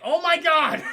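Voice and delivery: loud, high horrified outburst; mock horror. [name] finding the other girls, loud mock horror. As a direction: burst out in hammed-up horror. Escalating acted horror at discovery.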